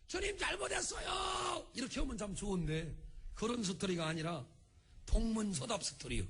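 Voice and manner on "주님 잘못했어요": very high, drawn-out mock plea; pleading apology. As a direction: beg forgiveness in an exaggerated high wail. Acting a repentant Peter begging forgiveness. (아주 높고 길게 잘못을 비는 척)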